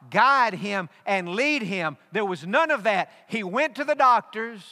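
full-force sermon climax; preaching. Acted. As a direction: drive the point home from the pulpit.

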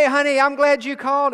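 lightly voiced loving mother; mother's voice. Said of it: "she'd say".

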